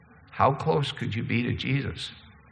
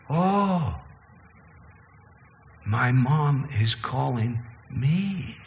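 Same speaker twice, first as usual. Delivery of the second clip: put-on slow, drowsy mumble of a half-asleep kid; groggy just woken; much slower than usual. Kid just woken for the bus, slow and drowsy. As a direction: drag the words out like you're barely awake.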